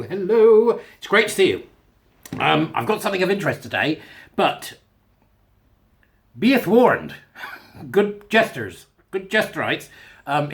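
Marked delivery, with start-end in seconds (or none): 0.0-1.6 a high, loud, bright hello to the viewers; big bright hello. Pretend. Greeting his viewers with a high, loud, bright hello. 6.4-7.2 high, loud, hammed-up be warned; mock ominous warning. Warning viewers of a meander, with a hammed-up ominous tone.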